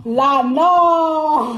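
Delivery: long, held "non"; drawn-out warning. Real, not acted. Warns about waking her devil.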